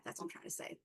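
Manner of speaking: breathy whisper under her breath; whispered aside. Real, not acted. To herself after fumbling her words, whispered. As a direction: mutter it to yourself.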